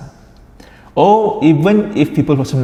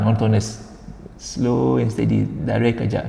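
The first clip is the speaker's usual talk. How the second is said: monotone; 6 dB quieter than usual. Shown as a demonstration. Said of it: "there is no up and down".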